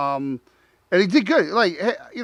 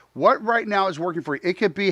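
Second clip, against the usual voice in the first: usual speaking voice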